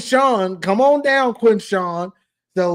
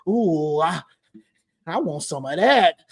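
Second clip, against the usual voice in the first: mock eager Caleb; 7 semitones lower, 3 dB quieter than usual. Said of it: "and he said".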